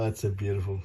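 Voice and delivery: normal talking voice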